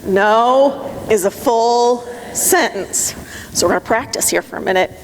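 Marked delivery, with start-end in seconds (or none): 0.0-3.1 very slow, every word firm; the audience chants it slowly; slow emphatic statement. Shown as a demonstration. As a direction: say it together, steady and deliberate. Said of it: "Say it with me".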